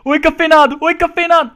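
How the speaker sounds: loud high call across the map; mocking shout at the enemy; shouted taunt. Real, not acted. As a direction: yell his name to taunt him. Calling out to enemy player.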